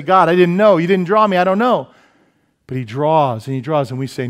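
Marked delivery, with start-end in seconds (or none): 0.0-1.9 higher, louder protesting plea to God; a sinner whining that nobody told him; pleading excuse. Retold or imitated. Sinner protesting to God, higher and louder.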